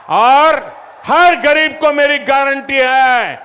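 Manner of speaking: high, loud rally shout. Real, not acted. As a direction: proclaim it to the crowd. Rally speech to crowd.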